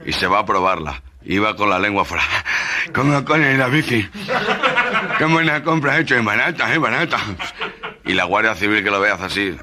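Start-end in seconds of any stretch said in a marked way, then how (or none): 2.9-7.2 pitched-up breathless nasal voice, gasping mid-words; nasal stutterer voice. Acted. Gangoso showing off his cheap bike, high and nasal. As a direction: puff out the words like a winded nasal man on a bike.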